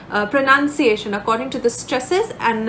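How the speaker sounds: ordinary delivery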